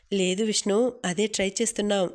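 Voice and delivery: plain reading voice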